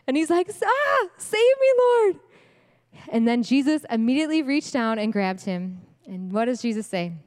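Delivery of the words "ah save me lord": mock cry for help. Sinking Peter cries out.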